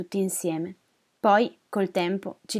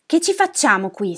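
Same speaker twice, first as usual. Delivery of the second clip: loud, higher-pitched complaint; lamenting old man; 5 semitones higher, 8 dB louder than usual. Acted. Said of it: "si lamentava un altro vecchio".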